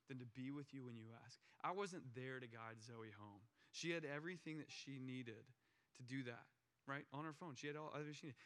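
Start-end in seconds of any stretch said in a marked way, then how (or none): none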